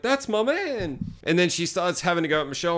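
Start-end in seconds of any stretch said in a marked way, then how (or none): 0.0-1.0 high, possessive alien-woman voice; female alien. Retold or imitated. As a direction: claim him in a high mock voice. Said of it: "she's like".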